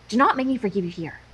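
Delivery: ordinary talk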